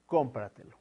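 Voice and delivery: cómpratelo with CÓM punched, the rest falling away; cómpratelo, all the weight on the opening syllable; stress on first syllable. Shown as a demonstration. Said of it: "en la ante-antepenúltima sílaba".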